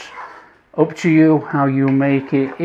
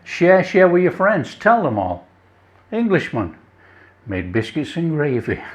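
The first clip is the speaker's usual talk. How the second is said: ordinary delivery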